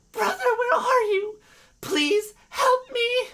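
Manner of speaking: high, loud cry for help; tearful plea. Acted. Frightened girl calls for her missing brother, tearful plea. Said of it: "She started to cry".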